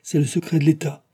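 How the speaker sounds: emphatic, categorical answer; firm, crisp reply. A narrator's reading. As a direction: shut the question down firmly. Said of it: "répliqua nettement D'Artagnan".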